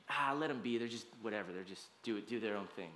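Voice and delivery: low, soft mock indifference; acted offhand dismissal; dismissive shrug. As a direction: wave it off, voice dropping away. Mimicking culture's indifferent attitude.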